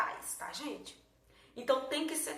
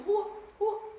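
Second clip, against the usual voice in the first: "Rua, rua" punched out high and loud, undivided; as one syllable; 10 semitones higher, 6 dB louder than usual. Shown as a demonstration. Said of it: "um monossílabo". ("Rua, rua" numa sílaba só, sem separar)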